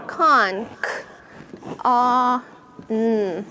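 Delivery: "Con", then "K", "Ah", "N" said as separate sounds; sounding out. Shown as a demonstration. Teacher breaking the syllable "con" into sounds for the class. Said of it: "What was the first syllable?".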